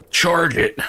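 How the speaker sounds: high, loud charge order; mock battle cry. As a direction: yell it like a cavalry captain, half joking. Rallying his horse at rat.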